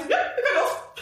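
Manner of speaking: slow, high, overly innocent reply with a laugh; feigned innocence. Retold or imitated. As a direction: play it sweet and casual, as if nothing happened. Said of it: "Und dann war ich so". (gespielt unschuldig, langsam und hoch mit Lachen)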